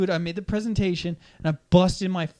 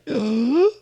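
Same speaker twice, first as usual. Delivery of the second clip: loud high gasp of shock; audible shocked gasp; class gasping; 8 semitones higher, 7 dB louder than usual. Retold or imitated. Classes react to insult.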